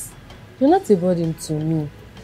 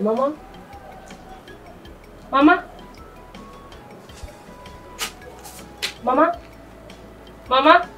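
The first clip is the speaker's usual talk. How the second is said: rising, anxious calls of Mama, each pitched higher; calling out; 7 semitones higher, 3 dB quieter than usual. Acted. Granddaughter calls her still grandmother, growing alarmed. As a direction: call her name, getting more alarmed.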